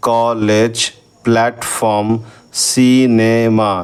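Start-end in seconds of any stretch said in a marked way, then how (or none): 2.7-3.7 cinema drawn out very slowly, each syllable apart; slow classroom syllable split. Shown as a demonstration. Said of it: "try syllable তিনটে ভাগ আছে".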